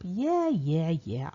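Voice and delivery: lightly voiced brushing-off, a touch sarcastic; dismissive yeah yeah. Narrator shrugging off Michael's warning, mildly dismissive. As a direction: wave the warning away with a mild mock yeah. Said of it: "I thought".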